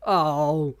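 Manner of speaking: loud, rising cries of awe, fading down; a lyric hits him hard; overwhelmed oh. Real, not acted. Hearing a powerful lyric, overwhelmed cries of oh.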